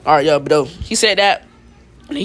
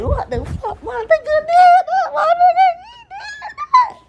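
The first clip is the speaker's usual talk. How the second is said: very high falsetto screech; stunned hype; slower than usual. Acted. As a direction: squeal it in drawn-out, hammed-up disbelief. Acting out the crowd's stunned reaction.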